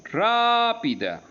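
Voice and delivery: "rápida" with RÁ held long and high; stress on RÁ. Shown as a demonstration. Teacher showing the accent in ra, "rápida" with stretched high RÁ. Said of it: "tiene el acento en ra".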